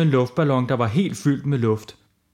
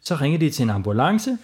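usual speaking voice

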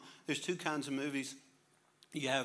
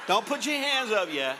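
louder, higher teasing command; playful loud warning; 8 semitones higher, 10 dB louder than usual. Real, not acted. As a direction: tease the crowd, holding them back. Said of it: "playfully warning congregation".

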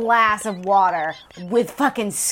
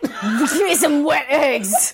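slow, higher, hammed-up spoiled whine; mock bratty demand; 6 semitones higher, much slower than usual. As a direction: stretch it out like a spoiled child. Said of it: "repeats the demand mockingly".

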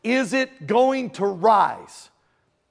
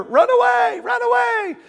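high panicked shouting knight; Monty Python run away; 5 dB louder than usual. Retold or imitated. Preacher quoting Monty Python to his congregation, high panicked shout. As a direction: yell it like the fleeing knights in Holy Grail. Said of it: "Monty Python, Holy Grail".

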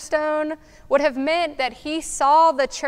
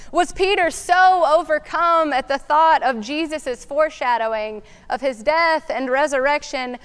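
ordinary talk, nothing marked

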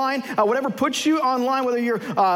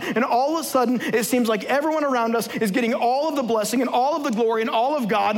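ordinary talk, nothing marked

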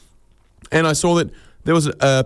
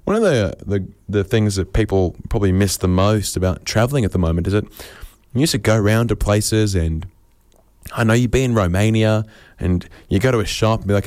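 ordinary delivery

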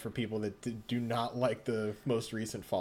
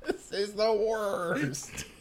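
drawn out words through laughter; laughing while talking; 9 semitones higher, 3 dB louder, much slower than usual. Real, not acted. Laughing at the odd voice.

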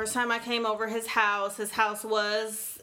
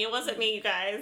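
normal talking voice

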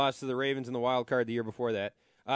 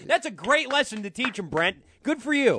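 higher, louder biting sarcasm; mock praise dripping with anger; sarcastic outrage; 7 semitones higher, 4 dB louder than usual. Real, not acted. Host sneering at Musburger, high loud sarcasm.

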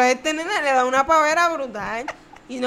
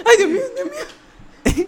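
high laughing imitation of frantic praying; mock panic; 10 semitones higher, 6 dB louder than usual. Mimicking mom praying in the quake, high and laughing. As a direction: wail it like a scared mother, half laughing.

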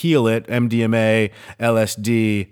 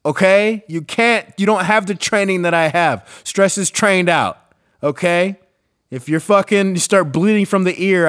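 higher, emphatic mock lecture; indignant rebuke; 7 semitones higher, 3 dB louder than usual. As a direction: put them in their place, pitched up and exasperated. Acted rebuke to stressed coworkers.

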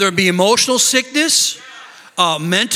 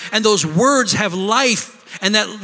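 ordinary talk, nothing marked

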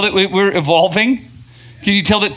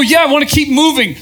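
high, louder, bright-laughing answer; breezy excited reply; 9 semitones higher, 4 dB louder than usual. Retold or imitated. Answering a doubting friend, excited and laughing. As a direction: toss it off eagerly, much higher. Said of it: "I just go".

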